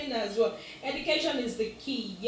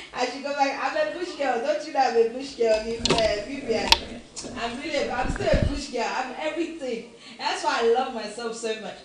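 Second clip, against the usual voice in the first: louder, laughing, proud; loud proud laughing claim; 6 dB louder than usual. Real, not acted. Louder, laughing, telling Vivian she is a bush girl. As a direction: shout it out with a laugh.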